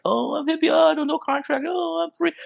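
high-pitched mocking hippie voice. Mimicking the blog writer as a naive hippie, high mocking voice. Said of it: "You can't be like".

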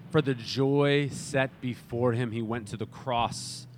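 a pastor crying out thanks to Jesus; fervent prayer. Acted. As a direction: lift the prayer with conviction. Prayer rises to preaching.

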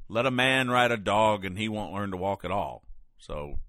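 usual speaking voice

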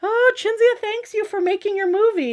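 high, mock-sweet teasing voice; teasing friends. Friends teasing the guest in front of Nick, mock-sweet. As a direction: tease her in front of the star. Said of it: "They're like".

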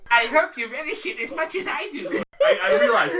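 put-on high, loud creepy child-alien voice; Baylock. Alien commander offering his drink to guests, high mock-gracious voice. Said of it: "my Clint Howard impression".

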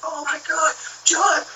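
melting down like seeing Jesus return; overjoyed reunion. Retold or imitated. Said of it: "They melted down like".

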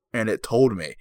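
normal talking voice

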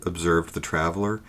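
usual speaking voice

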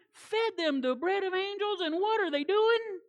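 ordinary delivery

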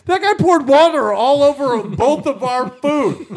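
high, loud incredulous protest; retold indignation. Arguing back at his date, mock-outraged. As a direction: protest at full pitch, comically outraged.

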